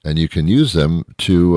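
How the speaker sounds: ordinary delivery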